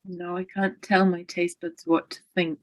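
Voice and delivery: usual speaking voice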